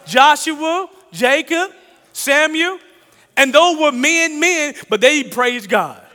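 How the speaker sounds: loud, high preaching call with the names drawn out; preacher rousing the congregation, slow and loud. Acted. Pastor to the congregation about praisers, loud preaching.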